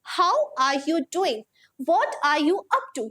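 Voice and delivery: each word spaced out, slow and clear; slow model sentences. Shown as a demonstration. Teacher modelling phrases for students, slow and clear. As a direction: read it slowly so students can copy. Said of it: "teacher models sentences slowly".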